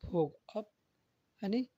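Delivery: ordinary delivery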